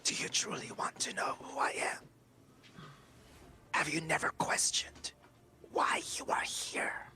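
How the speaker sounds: whispered, high demonic entity. Acted. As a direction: breathe it like an ancient demon. Demon voice held.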